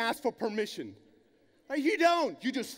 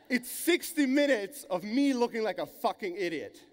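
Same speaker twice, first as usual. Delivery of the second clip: ordinary talk, nothing marked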